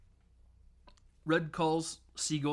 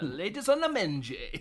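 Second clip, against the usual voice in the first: silly sign-off; 6 semitones higher than usual. Retold or imitated. Quoting an awkward silly phone sign-off.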